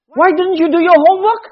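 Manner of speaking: mock a parent scolding a child. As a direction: parent nagging child about homework.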